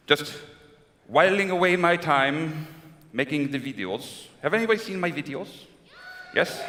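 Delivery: put-on suave Latin-lover schmooze in a thick Spanish accent; hammed-up smooth Spanish-accented charm; Fernando Lamas impression. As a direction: purr compliments at the crowd like an aging matinee idol with a Spanish accent. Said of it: "I picked a character that you guys don't know".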